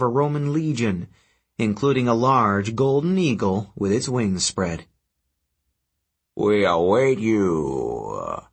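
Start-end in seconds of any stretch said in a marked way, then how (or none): 6.3-8.4 lower, very slow giant voice; fire giant. Acted. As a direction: rumble it slowly from the throne. Giant speaks from gloom.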